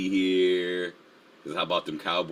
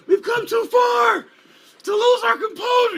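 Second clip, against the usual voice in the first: Will Ferrell impression; 6 dB louder than usual. Streamer quoting a movie coach's rant, shouted. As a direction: bellow it like an unhinged coach in a locker room. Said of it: "I feel like, uh, like Will Ferrell".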